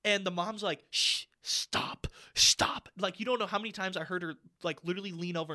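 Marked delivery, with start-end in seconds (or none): 0.9-2.9 sharp stage whisper; hushed scolding. Retold or imitated. Mom shushing her little girl, whispered scolding. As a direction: imitate a mom hissing at her kid to behave.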